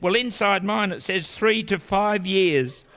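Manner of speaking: put-on high, slow child's voice; little kid. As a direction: kid voice delivering the punchline slowly. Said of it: "The kid said".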